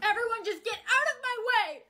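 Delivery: high, frantic command; upset cry, pitch jumping up; exasperated outburst. Acted. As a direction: burst out to clear a path. Flees as others gang up.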